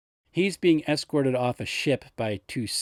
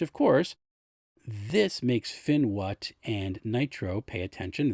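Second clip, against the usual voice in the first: ordinary delivery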